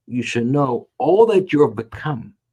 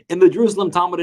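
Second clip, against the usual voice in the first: ordinary talk, nothing marked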